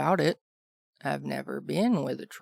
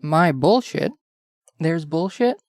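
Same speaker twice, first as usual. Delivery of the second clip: louder, playful fake outrage; mock offended; 2 semitones higher, 6 dB louder, slower than usual. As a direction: feign shock at the accusation.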